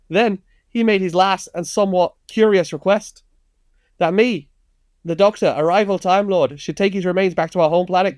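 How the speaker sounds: high-pitched comic Scouse reading; broad Scouse accent. Acted. Host resumes the film monologue, broad Scouse accent. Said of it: "not gonna do a very slight Scouse accent".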